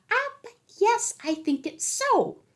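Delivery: high, firm leprechaun voice; insist, slow and sure, like a leprechaun; firmly. A narrator's reading. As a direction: leprechaun firmly overruling Pat. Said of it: "answered the leprechaun firmly".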